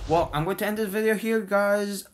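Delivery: bright, fast outro voice; youtuber outro. Acted. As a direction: wrap up the video cheerfully, quickly.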